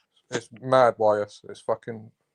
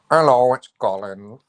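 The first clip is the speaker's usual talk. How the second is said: slow, louder put-on Colin voice; doing a mate's accent as a joke; imitating Colin; 6 dB louder, slower than usual. Joking impression of their mate Colin answering the phone. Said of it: "the easiest man to do the accent for".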